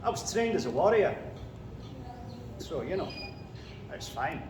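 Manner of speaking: put-on Macbeth in a Scottish accent; broad Scottish accent. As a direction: speak as a Scottish warrior king, Scottish accent. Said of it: "Do you want a quick example?".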